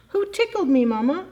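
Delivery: बहुत ऊँची, छोटे भालू के बच्चे जैसी आवाज़; छोटे भालू के बच्चे की तरह चौंककर पूछो; baby bear voice. Acted. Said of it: "asks baby bear".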